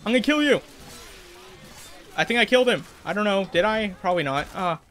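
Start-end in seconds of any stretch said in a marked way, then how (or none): 0.0-0.6 gamer taunt. Real, not acted.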